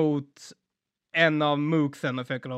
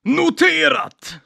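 slow, high, loud bellow; hammed-up hero shout; 12 semitones higher, 7 dB louder, much slower than usual. El Tauro acknowledges a warning while bleeding, hammed-up shout. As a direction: stretch each word into an over-the-top battle cry.

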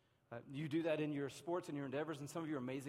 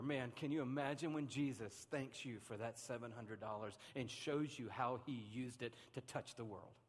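ordinary talk

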